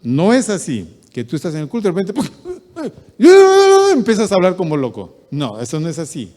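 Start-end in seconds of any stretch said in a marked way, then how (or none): 2.3-3.9 mock ecstatic cackle. Mocking ecstatic outburst in church.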